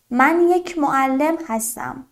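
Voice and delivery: normal talking voice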